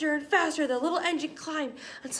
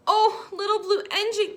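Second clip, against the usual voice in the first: high, loud plea; crying out; 8 semitones higher, 5 dB louder than usual. A narrator's reading. Toys crying out to the little blue engine. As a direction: cry out for help, lifted. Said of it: "cried the toy dolls".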